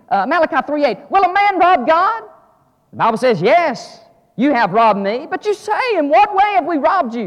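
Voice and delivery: loud, high, ringing preacher's call; climbing sermon cry; full-force preaching. Acted. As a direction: preach it out to the back row.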